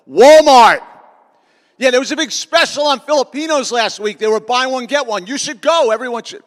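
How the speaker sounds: loud, high sarcastic retort; a big sarcastic comeback; sarcastic shout. Retold or imitated. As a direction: blurt it out with mock cheer. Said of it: "'cause I'm very sarcastic".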